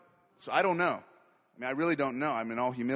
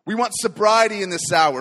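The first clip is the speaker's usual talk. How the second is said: much louder, pressing on sobriety; louder plea; 8 dB louder than usual. Acted. Preacher praying for sobriety, now forceful and louder. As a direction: repeat the plea with more force.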